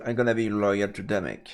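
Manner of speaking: normal talking voice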